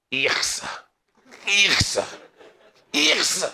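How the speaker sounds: mock revulsion, building in pitch; disgusted exclamation. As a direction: escalate from a plain yuck to a high, drawn-out yuck. Mimics someone's escalating disgusted cry.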